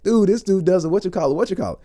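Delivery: high-pitched excited introduction; retold animated enthusiasm; Michael B. Jordan. Star excitedly tells his co-star.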